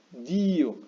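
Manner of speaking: dio split in two as DÍ-o, long stressed i. Shown as a demonstration. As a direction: lean hard on the i of dio. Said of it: "no estamos diciéndolo así".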